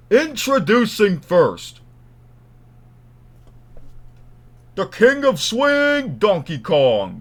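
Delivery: loud, high, drawn-out fight introduction; ring announcer. Pretend. Introducing Donkey Kong to a wrestling crowd, ring announcer. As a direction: bellow it slowly like a ring announcer. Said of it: "I can be like a ring announcer".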